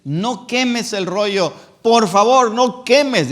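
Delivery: slow, imploring plea; pleading followers. Retold or imitated. Followers begging the king to spare the scroll, slow and pleading. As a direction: beg the king not to burn it. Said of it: "estaban rogando al rey".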